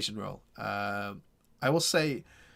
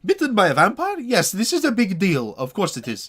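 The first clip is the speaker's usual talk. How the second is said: much higher, loud sarcastic disbelief; scoffing burgomaster; 9 semitones higher, 10 dB louder than usual. Acted. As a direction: ham up the sarcasm, high and loud. Burgomaster scoffs at vampire bite.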